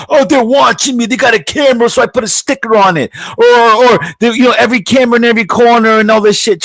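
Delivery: high-pitched hammed-up paranoid worrier; mock paranoia. Host imitating people who sticker their cameras, mock paranoid. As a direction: send up their panic, pitch way up. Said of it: "What are you so paranoid about?".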